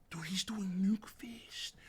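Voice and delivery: whispered in awe; awed whisper. Real, not acted. Reader hushed by the nuke fist, whispering. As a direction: breathe it out in amazement.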